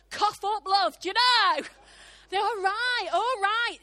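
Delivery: loud, high, hammed-up giving in; exasperated surrender. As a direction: shout it in comic exasperation. Acted giving in to God's insistence.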